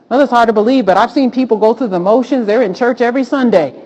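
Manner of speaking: ordinary delivery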